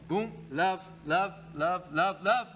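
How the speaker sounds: mild mock voice of God, building a little on the last "love"; God's voice. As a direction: lightly voice God insisting on love, love, love. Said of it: "And God's just going".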